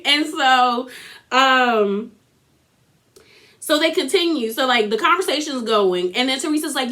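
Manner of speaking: ordinary delivery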